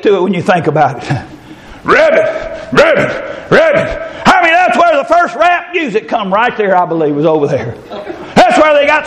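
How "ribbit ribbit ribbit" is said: rhythmic croaked ribbits; frog voice. Retold or imitated. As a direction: croak it to a beat. Frogs croaking to a beat.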